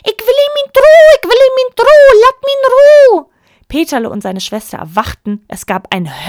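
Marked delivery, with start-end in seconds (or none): 0.0-3.3 very high, loud shrieking goose voice; screaming goose. A narrator's reading. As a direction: screech it at the top of your lungs. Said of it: "schrie sofort aus vollem Hals". (kreischende Gans aus vollem Hals)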